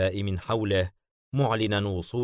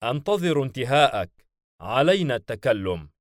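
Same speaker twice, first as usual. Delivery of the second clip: lightly mocking, raised voice; a touch of sarcasm, pitched up and louder; 5 semitones higher, 5 dB louder than usual. A narrator's reading. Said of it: "بلفيغور بتهكم". (تهكم خفيف)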